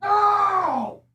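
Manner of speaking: very high shouted no; outraged shout. Real, not acted. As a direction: yell it out in outrage. Outraged reaction.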